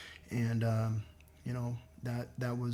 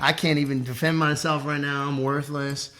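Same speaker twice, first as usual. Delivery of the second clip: bitter self-mockery; 3 semitones higher, 9 dB louder than usual. Facing prison, mock-cheerful bitter outburst. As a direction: spit it out at yourself. Retold despair before prison.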